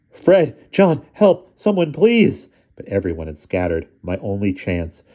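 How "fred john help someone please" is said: high, loud desperate calls; panicked cry for help. Acted. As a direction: scream for your friends to save you. Attacked, calling for help.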